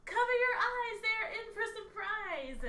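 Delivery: high, loud in-character shout; character yelling a drawn-out warning; yelled warning. Acted. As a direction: call it out high over the battle, stretching the end. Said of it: "she's gonna yell out".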